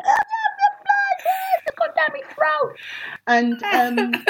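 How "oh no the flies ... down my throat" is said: high, shrieking panic over flies, half laughing; hammed-up shriek of disgust; mock screaming. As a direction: squeal it like flies just flew in your mouth. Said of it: "I'll be screaming".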